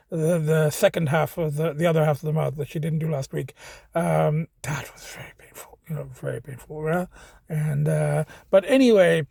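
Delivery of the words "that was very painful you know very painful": soft whispered complaint; whispering. Real, not acted. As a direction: breathe it out in a whisper. Recalling painful dental cleaning.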